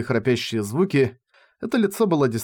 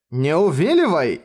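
narrated yell, only a little raised; restrained shout; 3 semitones higher, 3 dB louder than usual. A narrator's reading. Sion protests as Rainer twists his arm, mild shout. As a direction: cry out, holding back the volume. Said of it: "закричал Сион, что было духу".

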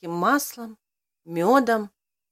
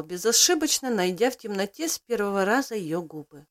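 ordinary delivery

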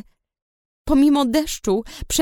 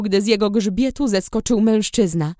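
ordinary delivery, nothing marked

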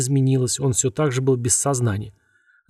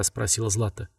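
ordinary delivery, nothing marked

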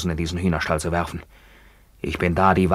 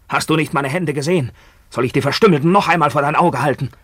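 rising higher and louder to a yell; building to a furious shout; 9 semitones higher, 7 dB louder than usual. Acted. Maimed soldier confronts his fiancée, building to a furious shout. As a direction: let the rage climb until you scream.

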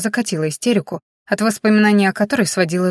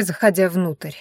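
ordinary delivery, nothing marked

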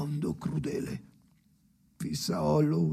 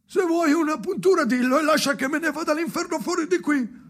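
high, fast, loud other voice; a jarring voice shouting from elsewhere; shrill other voice; 9 dB louder, faster than usual. Acted. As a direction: burst in far higher and faster. Recorded voice cuts in.

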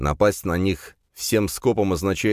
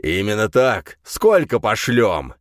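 eager growl; 4 semitones higher, 5 dB louder, slower than usual. A narrator's reading. Rin eager.

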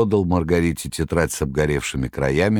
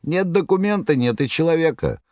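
much higher jester voice; Koroviev; 12 semitones higher than usual. Acted. Koroviev reassuring the master, high clownish voice. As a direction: jeer like a clownish trickster. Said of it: "удовлетворённо говорил Короев".

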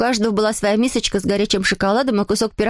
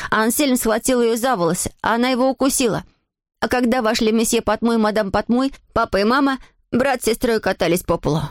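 usual speaking voice

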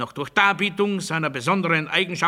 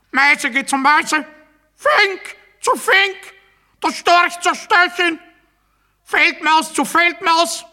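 escalating shout; 11 semitones higher, 7 dB louder than usual. Acted. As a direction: bark out each animal pair louder and higher. Pseudo-scientific rant, escalating.

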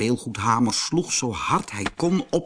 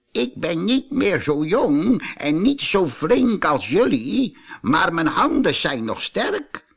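put-on higher old ape voice; a modest old ape offers help; old ape talking; 6 semitones higher than usual. A narrator's reading. Old ape offering help to the toys, higher voice. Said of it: "zei hij".

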